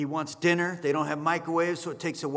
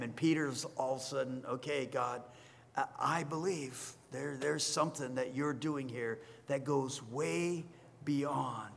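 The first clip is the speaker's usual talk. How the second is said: much softer, slowed; quiet awe; 4 semitones lower, 9 dB quieter than usual. Real, not acted. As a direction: draw them in quietly. Quiet, awed close.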